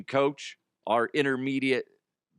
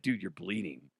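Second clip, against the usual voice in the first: flat, quiet quote; 6 semitones lower, 7 dB quieter than usual. Retold or imitated. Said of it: "he's like".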